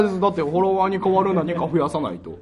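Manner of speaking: ordinary talk, nothing marked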